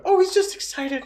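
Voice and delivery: very high grandma voice; high-pitched, excusing grandma impersonation; imitated grandma. Grandma excuses grandpa's short temper, very high imitated voice. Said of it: "my grandma would be like".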